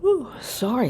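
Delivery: yawning out the words; mid-yawn. Real, not acted. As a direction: speak through a yawn.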